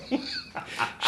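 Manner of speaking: soft, high wheeze of laughter; laughing. Real, not acted. Friend tickled by the Three Stooges memory, laughing. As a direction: laugh almost soundlessly.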